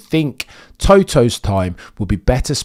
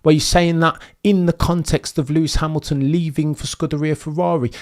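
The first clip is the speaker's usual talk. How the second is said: normal talking voice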